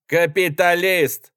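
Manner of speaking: loud, high, drawn-out jeer; jeering taunt. A narrator's reading. Young soldier on the platform taunts the passenger, jeering. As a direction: stretch the word out mockingly. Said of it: "сказал молодой солдат, который смеялся".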